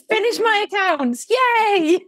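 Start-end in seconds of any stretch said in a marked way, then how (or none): 1.3-2.0 mock cheer. Real, not acted. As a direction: celebrate with a playful whoop. Cheering about finishing accounts.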